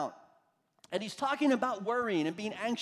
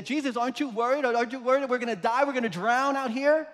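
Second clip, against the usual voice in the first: rushed, higher-pitched worried plea; mild mock panic; 4 semitones higher, 4 dB louder, faster than usual. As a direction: plead urgently as frightened disciples, in a hurry. Acted panicked disciples in the storm.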